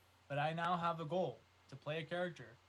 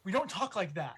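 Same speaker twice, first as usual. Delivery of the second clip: raised, higher telling-off; mock scolding; 6 semitones higher, 4 dB louder than usual. Woman grabbing a fellow New Yorker, scolding. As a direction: scold him in a retold outburst. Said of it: "she turned around and she, like, grabbed him".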